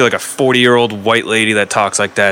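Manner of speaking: ordinary talk, nothing marked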